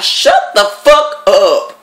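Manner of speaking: loud, high, slow-punched command; re-enacted exasperated shout at a crybaby; fed-up outburst. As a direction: hit each word hard and drawn out. Snapping at the crying classmate.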